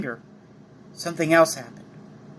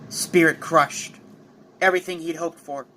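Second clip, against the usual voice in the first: plain reading voice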